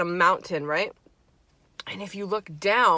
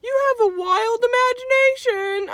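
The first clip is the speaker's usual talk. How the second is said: much higher mock mom voice; 4 dB louder than usual. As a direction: mimic mom brushing you off. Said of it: "my mom's always like".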